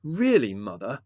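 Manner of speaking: exasperated outburst; exclaiming. A narrator's reading. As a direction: object indignantly. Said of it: "exclaimed Larry".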